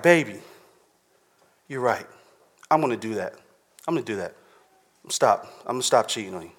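lightly voiced wife giving in; quiet pretend apology from the wife; meek apologetic wife. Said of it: "And say she was like".

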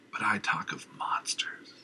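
ominous whisper. Acted. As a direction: hiss it in dread. Madman whispers.

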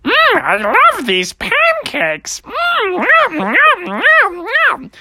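high, slow cartoon munching voice; silly high creature, noisily chomping; greedy ghost eating. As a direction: gobble and moan over delicious food. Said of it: "I heard someone saying".

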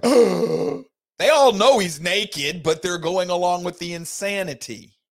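mock scandalized onlookers; shocked crowd. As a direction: react like a stunned crowd.

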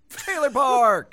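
high, loud, slow title call; announcer shout. Acted. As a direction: boom out the segment name.

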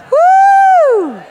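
very high, loud woo; exultant whoop. Real, not acted. Preacher celebrating before the congregation, exultant whoop. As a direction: let out a piercing victory whoop.

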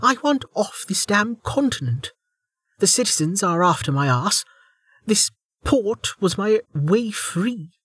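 angry woman. Acted. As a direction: vent her frustration. Velika's angry rant.

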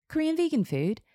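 high-pitched puzzled question; surprised echo. A narrator's reading. Hairstylist questioning client's cuisine, surprised. Stylist echoes answer in surprise.

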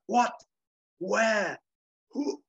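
high, halting stunned questions; Flugelman dumbstruck. Acted. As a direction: sputter one word at a time in mock shock. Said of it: "Flugelman stares, and his cigar falls".

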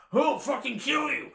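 high, loud hammed-up threat; furious mock battle yell. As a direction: charge in yelling, imitating an enraged friend. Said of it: "Ron's like".

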